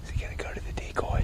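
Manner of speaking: hushed whispered question; wildlife watching whisper. Real, not acted. Deer approaching the decoy.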